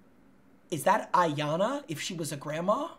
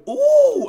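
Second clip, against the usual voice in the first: high, loud "ooh" of delight; excited ooh; 10 dB louder than usual. Real, not acted. Gamer spotting an Emerald lobby, excited high ooh. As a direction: squeal a loud, rising ooh.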